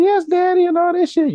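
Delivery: high falsetto mock porn moan; mock moaning woman. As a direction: squeal it like a woman in a porn clip. Mocking woman in porn video.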